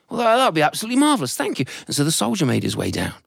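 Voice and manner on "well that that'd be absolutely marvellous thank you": high, fast grateful voice; a father gushing with relief; high quick thanks; relieved father. Acted.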